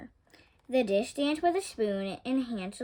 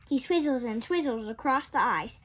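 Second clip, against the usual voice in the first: plain reading voice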